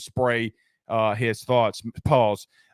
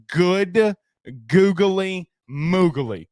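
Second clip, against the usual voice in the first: drawn-out exclamation; 4 semitones higher, 3 dB louder, much slower than usual. Real, not acted. Excited exclamation after the ad plug.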